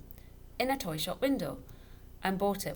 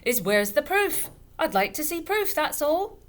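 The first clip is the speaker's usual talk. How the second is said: loud, high tipsy bluster; half-shouting drunk father; almost shouting; 10 semitones higher, 10 dB louder than usual. A narrator's reading. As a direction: raise your voice over the laughter. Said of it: "Mister Dovetail was almost shouting".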